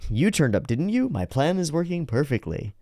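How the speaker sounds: high-pitched imitation of a gloating schemer; mock gloating villain. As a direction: crow over your plan, lightly hammed up. Acting out villain gloating over plan.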